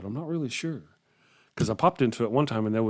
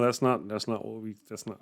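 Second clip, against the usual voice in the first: ordinary talk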